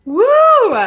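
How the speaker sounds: loud, high-pitched woo; excited whoop. Real, not acted. Reader celebrates her correct reading, excited whoop. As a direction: let out a big celebratory whoop.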